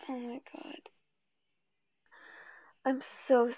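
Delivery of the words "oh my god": breathless unvoiced whisper; real frightened whisper. Real, not acted. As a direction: barely breathe it out, shaken. Scared after quitting game.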